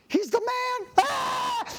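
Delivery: very high mock shriek of a scared kid; terrified child's scream. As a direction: squeal in panic like a frightened child, then scream. Acts screaming child seeing the dentist.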